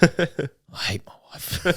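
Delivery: slow, whispery mock voice; mock put-on voice. Retelling a joke to a kid in a game lobby, slow mock whisper. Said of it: "And then I went".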